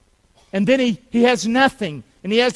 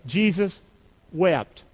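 "Jesus... wept", split by a deliberate pause; say-along; 3 semitones lower, slower than usual. Shown as a demonstration. Preacher leads congregation in reciting John, spaced out. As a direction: set "wept" apart, falling in pitch. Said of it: "say it with me".

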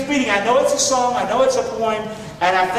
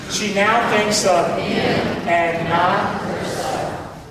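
drawn out, every word spaced; very slow unison recital; much slower than usual. Shown as a demonstration. Preacher leading the congregation in a very slow repeat. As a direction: lead them word by word. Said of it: "One more time".

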